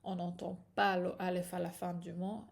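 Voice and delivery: ordinary talk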